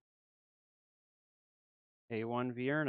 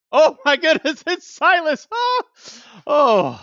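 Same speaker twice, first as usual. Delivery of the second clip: much higher, loud squeal with laughter; shrieking joy; 20 dB louder, slower than usual. Real, not acted. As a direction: scream with delight at the pull. Thinks she pulled Silas.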